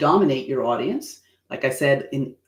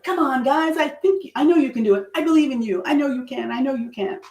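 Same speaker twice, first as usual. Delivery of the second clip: much higher, bright pep-talk voice; cheerleading; 10 semitones higher than usual. Shown as a demonstration. Cheering on an audience she believes in, high and eager. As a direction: cheer them on like a coach. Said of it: "you're cheerleading for people".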